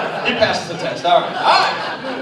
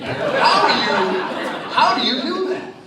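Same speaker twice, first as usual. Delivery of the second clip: laughing disbelief; 7 semitones higher, 4 dB louder, slower than usual. Real, not acted. Host amazed, laughing at answer.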